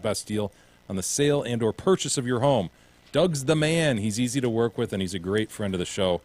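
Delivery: upbeat radio ad read. Acted. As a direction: sell it like a radio spot. Reads a realtor ad.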